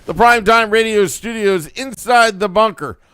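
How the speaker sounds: high, punchy show-opening announcement; show opener. Real, not acted. Host opening a late-night Devils broadcast, punchy announcer. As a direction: announce the live show with gusto.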